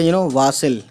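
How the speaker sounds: ordinary delivery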